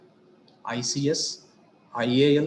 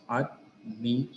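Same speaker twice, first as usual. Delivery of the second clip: "Admiration" split into ad, mi, ray, shun with pauses; syllable by syllable; 2 semitones lower, 3 dB quieter than usual. Shown as a demonstration. Teacher counting the syllables in a word, "Admiration" syllable by syllable. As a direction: break "Admiration" into four separate syllables. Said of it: "how many syllables?".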